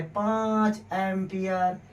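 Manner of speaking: slow, drawn-out पाँच ampere with पाँच leaned on; lovingly stressed. Shown as a demonstration. Teacher savouring the value for students, slow and fond. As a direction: linger fondly on पाँच ampere. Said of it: "बड़े प्यार से बोला गया है".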